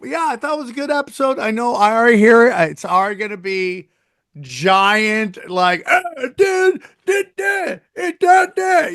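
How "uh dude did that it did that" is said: high-pitched imitation of people yelling; hammed-up, high strained yelling; mock yelling. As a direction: squawk it like a heated debater. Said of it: "You're just gonna yelling that".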